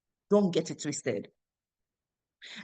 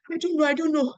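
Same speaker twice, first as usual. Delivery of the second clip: tearful helplessness; 10 semitones higher, 3 dB louder than usual. Retold or imitated. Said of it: "I said".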